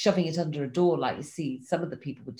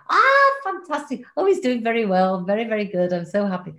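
mock delight; 5 semitones higher, 5 dB louder than usual. Acted cheerful reply to nurse.

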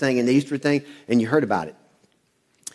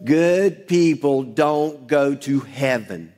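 slow call-and-response; much slower than usual. Shown as a demonstration. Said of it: "Say it with me".

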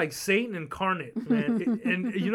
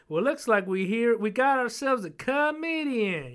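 slow, higher southern drill-sergeant drawl; Southern sergeant ribbing a recruit, higher and slow; 6 semitones higher, 4 dB louder, slower than usual. Retold or imitated. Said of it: "that southern draw".

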